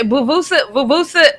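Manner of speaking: garbled "Vuvusa", tried out twice; Kendrick Perkins. Retold or imitated. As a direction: lightly mimic a botched name as "Vuvusa". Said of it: "What did he say?".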